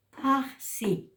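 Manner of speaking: high PAXI with a rasped breathy x; aspirated J. Shown as a demonstration. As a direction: push breath through the x of PAXI. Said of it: "sino decimos".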